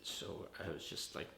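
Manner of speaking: ordinary delivery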